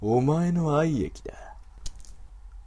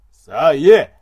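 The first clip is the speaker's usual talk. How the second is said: louder, pushy command; loud demand; 3 semitones higher, 13 dB louder than usual. Acted. Wolf man demands the woman say it, loud command. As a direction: force her to say it.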